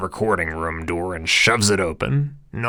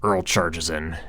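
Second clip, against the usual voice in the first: ordinary delivery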